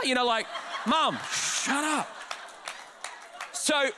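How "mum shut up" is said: high, drawn-out mock protest; slow, exasperated plea to stop; embarrassed son. Son to his joking mum, mock-mortified.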